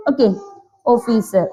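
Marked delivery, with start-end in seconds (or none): none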